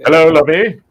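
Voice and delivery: loud put-on character greeting; Thurston Howell. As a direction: call it out in a sitcom character's voice. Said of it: "is that Thurston Howell".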